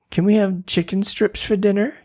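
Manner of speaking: put-on high, eager little girl asking; child voice. Tina asking Mom for chicken strips, high and eager. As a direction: pitch it up like a kid begging.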